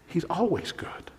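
breathy hush of conviction; whispered reassurance that he's always good. Real, not acted. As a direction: whisper it like a secret truth. Whispered answer to his question.

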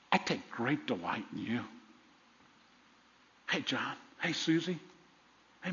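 hushed, intimate reassurance from God; tender Father. Acted. Voices God's love to each listener.

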